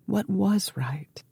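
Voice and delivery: slow, breathy whisper to herself; whispered doubt. Acted. Woman alone asks herself what is right, whispered slowly. Her despairing inner question.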